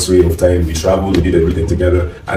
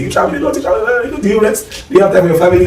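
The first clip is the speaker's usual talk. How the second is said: nagging people voice; 14 semitones higher, faster than usual. Retold or imitated. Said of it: "People say".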